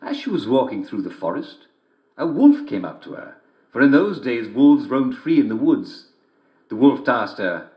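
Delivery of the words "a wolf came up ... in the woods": ordinary delivery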